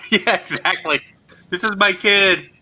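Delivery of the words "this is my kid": mock pleading dad. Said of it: "the guy on the Titanic".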